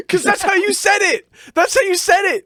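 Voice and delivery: high-pitched defense through laughter; laughing protest. Real, not acted. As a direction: protest helplessly while cracking up. Laughing protest when challenged.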